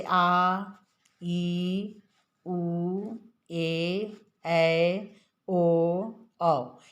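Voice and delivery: आ, ई, उ, ए, ऐ, ओ, औ each held long and evenly, with pauses; long vowels. Shown as a demonstration. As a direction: recite each of आ, ई, उ, ए, ऐ, ओ, औ as a drawn-out vowel. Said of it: "दो मात्रा का समय लगता है".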